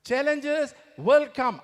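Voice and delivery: loud, slow, pressed declaration; emphatic warning. Real, not acted. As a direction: drive it home to the crowd. Motivational emphasis.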